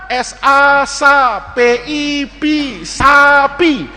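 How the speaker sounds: slow letter-by-letter reading; pupil sounding out. Acted. Six-year-old reads for teacher, slow spelling. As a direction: spell slowly, then say the word. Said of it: "Coba [name] baca".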